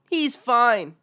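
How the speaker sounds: high, loud snooty brush-off; dismissive mom. Acted. Entitled mother dismissing the kid, high and loud. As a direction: wave it away like a snob mom.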